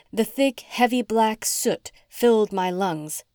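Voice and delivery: ordinary delivery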